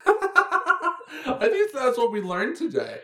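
high, slow, laughing through the words; giddy laughing wrap-up. Real, not acted. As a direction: laugh your way through the summary. Laughing conclusion.

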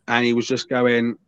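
ordinary talk

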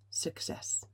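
Success said as a breathy unvoiced whisper; soft whisper. Real, not acted. Host closes her point, softly whispered Success. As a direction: let the word success fall away to a whisper. Summing up the topic.

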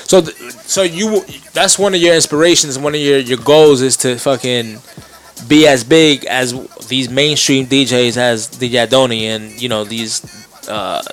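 usual speaking voice

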